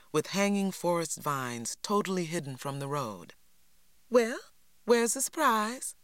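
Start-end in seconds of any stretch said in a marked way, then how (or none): 4.1-6.0 Lily's voice. A narrator's reading. Lily voiced, high.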